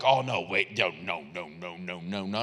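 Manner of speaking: deep, dropping mock disbelief; doubtful listener. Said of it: "and they're like".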